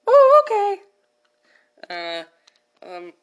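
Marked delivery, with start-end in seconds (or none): 0.1-0.8 high, meek, obedient reply; high sheepish mom. Acted. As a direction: answer meekly, high. Mommy Pig hushed.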